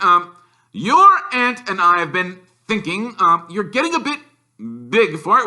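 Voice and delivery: strained, high, fake-friendly uncle; forced niceness. A narrator's reading. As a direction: smile through clenched politeness. Uncle Vernon forcing a smile.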